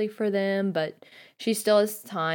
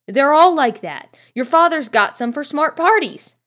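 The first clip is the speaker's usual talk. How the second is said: higher and louder motherly scold; 8 semitones higher, 10 dB louder than usual. Acted. Mrs Weasley argues with Ron over robes, louder scold. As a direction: push back firmly, voice up.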